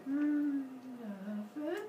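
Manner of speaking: high, coy hum; hammed-up guilty innocence; feigned innocence. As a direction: play innocent with something behind your back. Kronos hides the scythe.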